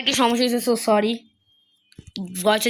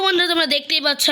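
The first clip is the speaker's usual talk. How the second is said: ordinary talk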